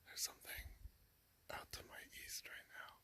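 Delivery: tense hushed whisper. Real, not acted. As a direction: barely breathe the words out. Something moving nearby in the dark.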